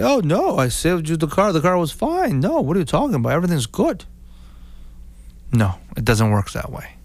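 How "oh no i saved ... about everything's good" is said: higher, lightly voiced seller brushing it off; mock seller denial. Seller telling the buyer the car was fine, mild mock denial. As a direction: play the seller waving off the complaint, half-hearted. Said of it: "the guy said".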